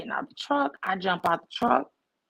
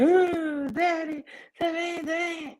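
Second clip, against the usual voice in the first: put-on high, wailing little-kid cry; imitated crying child; 10 semitones higher, slower than usual. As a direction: wail to daddy like an upset child. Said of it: "And I'm just crying".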